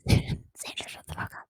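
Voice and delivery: stifled laughing whisper; giggly prank whisper. Real, not acted. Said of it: "I can't whisper into the mic".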